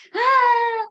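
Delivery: loud, very high drawn-out scream; imitated child's scream. Mother showing how her son screamed, piercing yell. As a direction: mimic a screaming tantrum. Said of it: "Él empezó a gritar".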